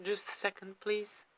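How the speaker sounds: soft, choked pause to regroup; quietly collecting herself through tears; holding back tears. Real, not acted. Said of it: "I'm trying not to cry".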